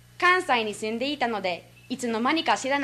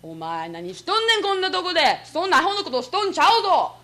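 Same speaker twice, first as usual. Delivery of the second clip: imitated angry father; 6 semitones higher, 4 dB louder than usual. Father catching his son at pachinko, hammed-up scolding. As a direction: tell off your son in rough Kansai dialect. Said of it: "お父さんが見つけた時".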